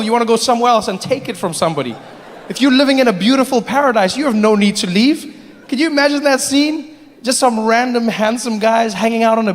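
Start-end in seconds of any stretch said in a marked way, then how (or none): none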